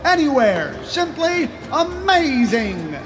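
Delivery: high-pitched carnival barker pitch; snake oil seller. Acted. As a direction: hawk it to the crowd. Carnival pitchman selling ladder.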